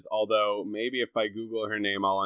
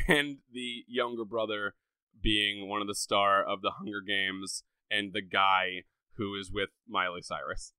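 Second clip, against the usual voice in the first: normal talking voice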